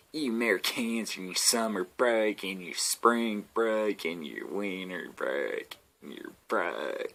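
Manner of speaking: slow teasing mockery; mocking tone. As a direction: tease them, slowing down. Mocking Americans' breaks.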